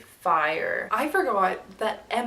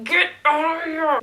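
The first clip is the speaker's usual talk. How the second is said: high, loud get-out command; angry dismissal; 7 semitones higher, 5 dB louder than usual. Retold or imitated. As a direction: throw a drunk out. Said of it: "she's all pissed off at him".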